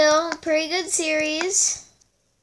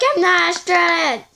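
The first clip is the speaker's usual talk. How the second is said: louder, higher mock outburst; exasperated retold protest; 4 semitones higher, 6 dB louder than usual. Player retelling her denial to teasing fans, exasperated. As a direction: burst out in protest.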